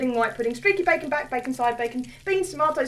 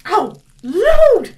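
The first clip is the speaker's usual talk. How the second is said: shocked gasp; 5 semitones higher, 11 dB louder than usual. Acted. Landlady realises who he is.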